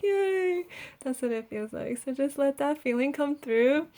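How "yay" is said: high little yay; pure childlike glee; happy squeal; excited cheer. Pretend. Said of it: "And then you're like".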